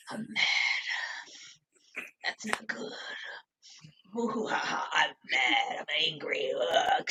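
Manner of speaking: low, hushed brooding impression; hammed-up quiet sulking; mock sulky Evan. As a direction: drop to a soft, deep mock grumble. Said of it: "Evan was like".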